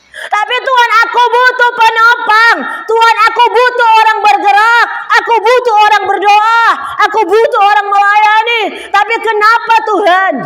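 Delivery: loud, high desperate plea; crying out to God. Retold or imitated. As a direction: beg God with full force, each need hammered. Retold desperate cry to God.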